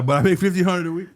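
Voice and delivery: laughing through the punchline. Real, not acted. Telling friends the story, laughing. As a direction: chuckle the words out.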